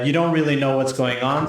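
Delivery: ordinary delivery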